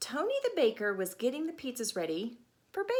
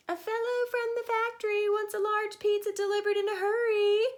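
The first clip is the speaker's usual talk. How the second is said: much higher, louder urgent wife's call; 10 semitones higher, 5 dB louder than usual. Acted. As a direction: call it across the shop like Tony's wife. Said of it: "Tony's wife called".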